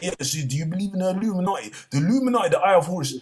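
put-on high, eager kid voice; kids' voice. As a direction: play the excited kids asking. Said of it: "They said".

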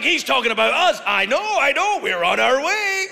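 high, loud gleeful impersonation; excited mock Canadians. Comic playing Canadians happy to be included, excited high voice. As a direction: pipe up high, drawing out the final phrase.

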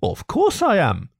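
high, eager little mole voice; loyal. A narrator's reading. Mole backs his friend Rat, loyally. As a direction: pipe up in staunch agreement. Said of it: "said the Mole loyally".

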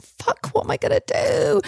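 very high, strangled panic; panicked squeak. Retold or imitated. Alone in the toilet, panicking in a high strangled squeal.

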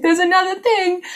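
high, laughing put-upon sigh of a line; laughing mock exasperation. Mocking Twitter's endless updates: high laughing exasperation. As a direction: laugh through it like it's the hundredth update. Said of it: "it's like".

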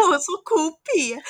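high, loud laughing retort; shrieking with laughter while repeating the jab. Real, not acted. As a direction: blurt it out through a laugh. Laughing after sister's jab.

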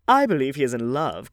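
crying out. A narrator's reading. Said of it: "cried Lady Narborough".

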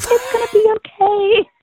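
put-on a sweet, reassuring pageant girl calming a nervous newcomer; imitating Rachel Wyatt. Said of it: "That's pretty good".

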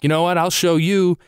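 high-pitched mock bravado; mock tough threat. Mocking himself for punching the mirror, mock-tough bravado. Mocks his own feeble tough act.

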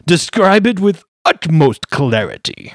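a pompous high-voiced lawyer flattering a client; pompous gentleman. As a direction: gush like a fussy wizard lawyer in a top hat. Lawyer character continues.

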